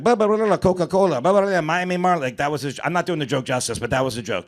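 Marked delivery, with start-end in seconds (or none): 0.0-2.2 lightly imitated newscaster gibberish, pitched higher; hint of a rapid news anchor; mock Spanish news. Comic retelling Tommy Davidson's Spanish news bit, light mock anchor. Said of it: "he would say".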